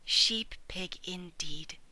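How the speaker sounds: slow whispered scoff; scornful whisper. Acted. Sheepdog thinking about piglet, whispered scoff. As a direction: breathe it to herself, amused.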